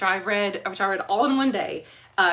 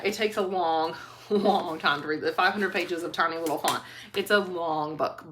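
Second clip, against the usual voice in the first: usual speaking voice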